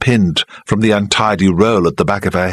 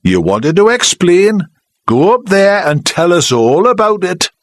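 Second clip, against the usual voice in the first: sulky challenge; 11 semitones higher, 3 dB louder than usual. Acted. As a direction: dare her, grudgingly. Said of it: "Charlie said sulkily".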